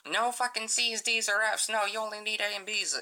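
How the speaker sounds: strict mom. Retold or imitated. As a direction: parody a strict parent laying down the rules. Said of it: "one of those fucking parents".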